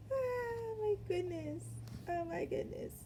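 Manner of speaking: high and slow through laughter; cracking up. Real, not acted. Still laughing at her joke, words high through laughter. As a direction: gasp it out while still laughing. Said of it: "I'm cracking up".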